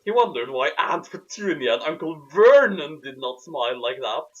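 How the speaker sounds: ordinary talk, nothing marked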